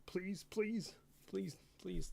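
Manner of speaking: small, high-pitched plea; playful pleading. Pretend. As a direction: beg lightly, please after please. Begs not to get in trouble.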